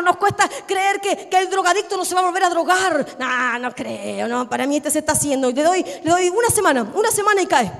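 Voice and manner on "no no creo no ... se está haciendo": dismissive disbelief. Acted. Sceptic judging a reformed drug addict, dismissive disbelief. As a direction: stretch the opening words in low-pitched doubt.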